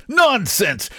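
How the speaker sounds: high-pitched, exclaimed objection; indignant protest. A narrator's reading. Painter rejecting his friend's percentage idea, high indignant protest.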